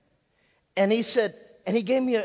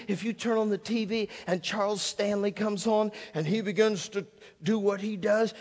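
usual speaking voice

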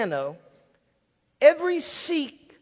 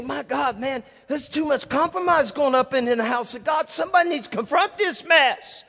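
louder, higher exasperated protest building at the end; acted indignation; 5 semitones higher, 4 dB louder than usual. Churchgoer fed up with compromise, indignant outburst. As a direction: rail against what you see, rising in force. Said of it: "you say".

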